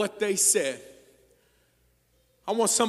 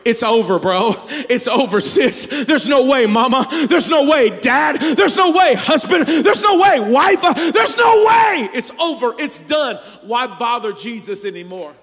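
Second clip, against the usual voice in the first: shouting; 2 semitones higher, 10 dB louder than usual. Acted. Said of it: "They said".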